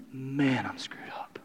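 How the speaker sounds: hushed, ashamed whisper; whispered confession. Retold or imitated. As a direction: breathe it out, defeated. Congregation voiced confessing.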